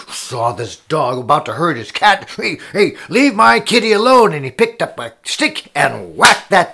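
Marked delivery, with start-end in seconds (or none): 2.4-4.3 raised, higher acted shout; indignant cat owner. Cat owner yells at dog about to hurt cat, indignant. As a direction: yell to protect your pet.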